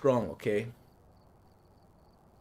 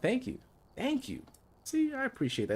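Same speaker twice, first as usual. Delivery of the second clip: flattered; 12 semitones higher, 5 dB quieter than usual. Real, not acted. Flattered by chat compliment.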